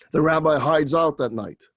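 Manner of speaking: plain reading voice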